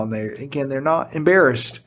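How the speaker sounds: normal talking voice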